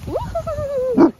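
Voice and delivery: fast high cheering hoots; excited woo-hoo. Real, not acted. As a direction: whoop it up for the dog. Excited call to dog.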